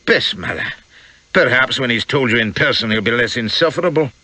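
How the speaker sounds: put-on grudging grumble in a Scots accent; Peitingi's Scots growl. A narrator's reading. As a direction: complain in a broad Scottish accent. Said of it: "growled Peitingi".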